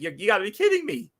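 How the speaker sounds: imitating a friend's stunned squeal; stunned disbelief. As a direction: let the pitch fly up in shock. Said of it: "And then he's like".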